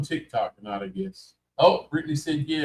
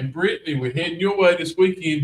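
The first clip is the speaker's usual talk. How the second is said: higher, slightly raised calling voice; hollering; 5 semitones higher, 5 dB louder than usual. Real, not acted. Host telling a viewer they are coming, hollering. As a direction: call it out to a far-off viewer. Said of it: "Feel like we're hollering".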